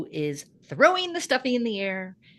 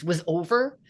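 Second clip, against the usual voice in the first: normal talking voice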